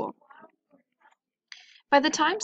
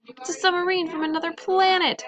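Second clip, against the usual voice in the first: high panicked shriek; 9 semitones higher, 3 dB louder than usual. A narrator's reading. Coach panicking at the creature in the pool, shrieking. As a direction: shriek in terror at the monster. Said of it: "shrieked the coach".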